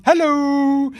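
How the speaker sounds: high, loud singsong hello; hammed-up LeBron begging to be noticed. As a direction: call out for attention. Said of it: "LeBron's just like".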